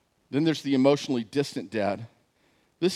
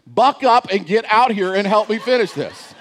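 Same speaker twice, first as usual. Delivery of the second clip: wife's brisk order; 9 semitones higher, 9 dB louder than usual. Retold or imitated. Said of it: "Dee Dee always comes in and says".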